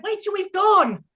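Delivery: high, loud, urgent hushing plea; frantic plea to the waiter in a restaurant; panicked plea. Retold or imitated. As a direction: beg him to hold off, panicking. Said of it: "And I went".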